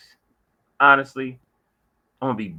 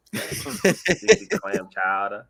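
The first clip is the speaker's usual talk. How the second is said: slow, laughing mock Boston accent; hammed-up Boston chowder seller; 3 semitones lower, much slower than usual. Joins in the Boston accent joke.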